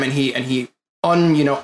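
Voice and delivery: usual speaking voice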